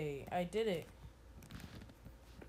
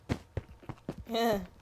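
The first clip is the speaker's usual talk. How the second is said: relieved giggle. Real, not acted. Laugh after the scare.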